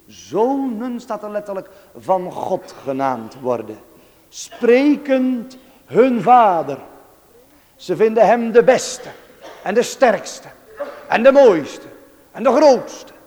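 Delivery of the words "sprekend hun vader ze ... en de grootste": loud, measured proclamation; emphatic preaching. Real, not acted. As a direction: preach each superlative as a ringing punch. Preacher builds up praise.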